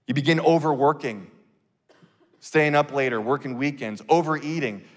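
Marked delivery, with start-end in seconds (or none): none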